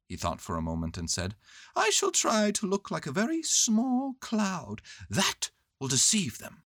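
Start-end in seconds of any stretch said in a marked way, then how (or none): 1.8-6.6 high storybook bear voice; high-voiced bear announcing a plan; Winnie the Pooh. Bear planning to fool bees, high voice.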